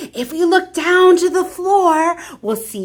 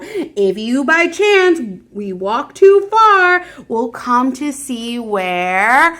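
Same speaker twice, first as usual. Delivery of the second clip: usual speaking voice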